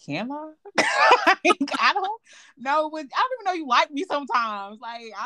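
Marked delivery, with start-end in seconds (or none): 0.0-0.5 high, startled can I; retold surprised reply. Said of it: "I was like". 0.6-1.2 loud high laugh. Real, not acted. Laughing at own story.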